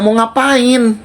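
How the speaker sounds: pressing her for an answer; retold challenge. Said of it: "Gue bilang gitu".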